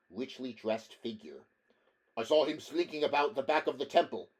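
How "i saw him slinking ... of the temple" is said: eager for praise. Acted. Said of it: "looking for commendation".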